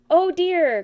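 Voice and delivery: high, loud alarmed cry. A narrator's reading. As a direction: Rabbit panicking as Pooh falls. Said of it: "cried Rabbit".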